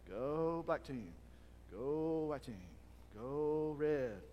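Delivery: slow, flat-hearted go team; heartless cheer. Retold or imitated. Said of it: "There's no heart there".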